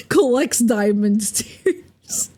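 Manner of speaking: words squeezed out through laughter; slow, giggling through the words; real laughter breaking the line; laughing. Real, not acted. Laughing while showing tears.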